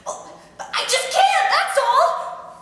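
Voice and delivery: high, overwrought outburst; hysterical. Acted. Wife refusing her husband mid-quarrel, hysterical. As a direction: burst out high, at the end of your tether. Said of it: "Corey, you're hysterical!".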